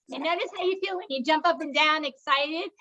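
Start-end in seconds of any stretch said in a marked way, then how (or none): none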